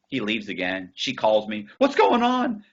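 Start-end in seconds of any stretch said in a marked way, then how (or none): 1.8-2.6 high, loud frantic demand; panicked girlfriend. Retold or imitated. Girlfriend calls him in the bank, high and panicked. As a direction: imitate a frantic girlfriend, pitched way up.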